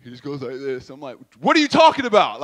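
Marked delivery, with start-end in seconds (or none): 1.4-2.4 a loud, fast, upset demand. Retold or imitated. Big brother demands answers from his crying brother, loud and fast. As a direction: fire the question at him, worked up. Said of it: "I'm getting upset".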